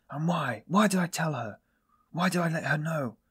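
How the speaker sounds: high-pitched anguished questions; despairing cry. A narrator's reading. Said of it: "he cried a minute later in despair".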